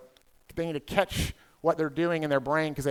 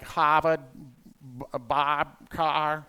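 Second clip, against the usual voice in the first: R-less Boston "Harvard" and "Carr"; Boston accent; much slower than usual. Retold or imitated. Comic showing Boston differs from New York, Boston accent. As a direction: slowly attempt a Boston accent, dropping the Rs. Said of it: "They don't sound the same at all".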